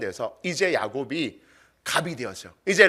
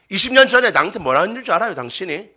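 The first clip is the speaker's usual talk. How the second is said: fast, higher mock scolding; nagging spouse; 5 semitones higher, 4 dB louder, faster than usual. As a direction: dredge up an old grievance quickly. Acting spouse dredging up old grievance. (빠르게 따지는 부부싸움 말투)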